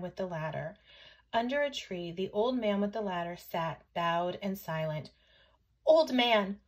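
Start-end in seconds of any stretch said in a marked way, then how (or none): 5.9-6.6 loud call, leaping higher the second time; shouting. A narrator's reading. Chang calls the old man with the ladder, shouting. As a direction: call out urgently to rouse him. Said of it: "shouted Chang".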